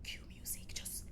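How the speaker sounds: hushed, urgent whisper. Retold or imitated. Colleague whispering to the host on air to cut the show. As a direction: whisper it fast so the mic won't catch it.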